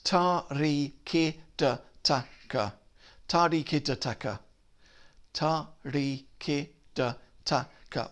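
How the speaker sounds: "Ta, ri, ki, da, taka" short and detached; staccato. Shown as a demonstration. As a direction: clip each syllable of "Ta, ri, ki, da, taka" apart. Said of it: "All very staccato syllables".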